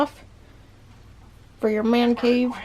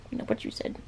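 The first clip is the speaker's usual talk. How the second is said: whispered aside; 12 semitones lower, 13 dB quieter than usual. Real, not acted. Murmured aside to the parrot.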